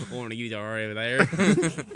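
loud, hammed-up Irish accent; teasing, loud Irish-sounding imitation; mock Irish accent. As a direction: mimic a botched accent that lands on Irish. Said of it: "that's an Irish accent".

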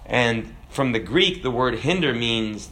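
ordinary delivery

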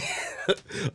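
silent wheezing laugh; breathy giggle. Real, not acted. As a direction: laugh escaping, unvoiced. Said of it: "I'm busting up".

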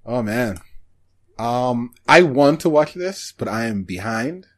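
ordinary talk, nothing marked

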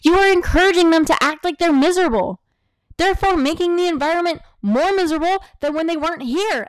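loud, high-pitched protest; worked-up, emphatic complaint; exasperated rant. Real, not acted. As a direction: raise your voice in exasperation, pitch pushed up. Exasperated rant at imagined enabler.

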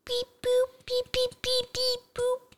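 robot beeping. Pretend. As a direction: beep like a little robot toy. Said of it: "Going robot mode?".

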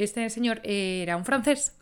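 ordinary delivery

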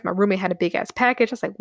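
usual speaking voice

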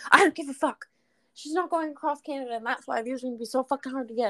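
high start, then rushed retold rant; mock indignant, fast rant; ranting friend. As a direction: imitate a worked-up friend firing back. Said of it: "she was going on a rant".

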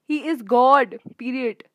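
loud, emphatic declaration, as if typed in all caps; hammed-up all-caps proclamation. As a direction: shout it like a text in all capitals. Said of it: "in all cap".

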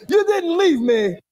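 ordinary delivery

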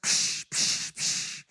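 soft unvoiced scoffing "psh" sounds; scoffing puffs. Husband imitating his own constant complaining, soft scoffing puffs. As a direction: puff out air dismissively, quietly. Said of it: "and I kept just".

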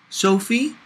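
put-on light high child's voice, questioning; lightly voiced boy calling to Sophie from the shower; little boy asking. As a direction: ask like a small curious kid.

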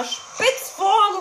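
ordinary talk

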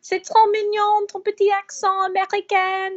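high-pitched cooing in French; cooing French admirers. Retold or imitated. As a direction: gush over it as if adorably cute. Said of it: "tout le français dit".

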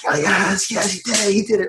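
acted excited fan outburst; imitated TV yelling. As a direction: squeal it at the screen in triumph. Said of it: "yelling at my T V".

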